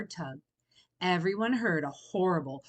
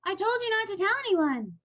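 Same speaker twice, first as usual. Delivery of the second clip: higher, louder reproach; upset cry; 12 semitones higher, 5 dB louder than usual. A narrator's reading. Franklin upset, crying out at Fox. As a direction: wail the blame at him. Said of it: "cried Franklin".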